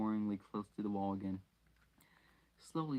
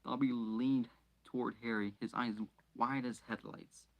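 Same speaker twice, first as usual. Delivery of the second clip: ordinary delivery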